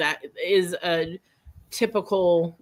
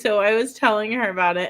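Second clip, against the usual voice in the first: ordinary delivery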